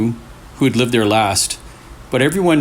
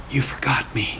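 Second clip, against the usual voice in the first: soft unvoiced whisper, much softer; ghostly whisper; 6 dB quieter, faster than usual. A narrator's reading. The voice on Leo's phone whispers, ghostly. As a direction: hiss it like a ghost on the line.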